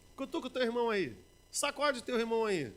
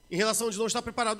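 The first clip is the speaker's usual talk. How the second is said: ordinary talk, nothing marked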